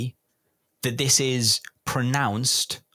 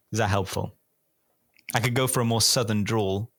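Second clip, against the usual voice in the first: ordinary talk, nothing marked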